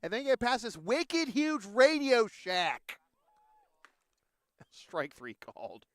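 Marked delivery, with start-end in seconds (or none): 0.0-3.0 hammed-up Boston accent, higher and louder; playful mock Boston accent showing it off. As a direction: lean hard into a thick Boston accent. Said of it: "is this a Boston accent to you?".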